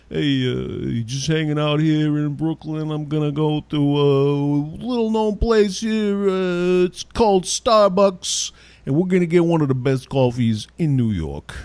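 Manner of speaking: exaggerated New York guy selling a hidden gem; stupid New York voice. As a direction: play a New Yorker touting a chain like a secret. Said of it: "in that stupid, like, New York voice".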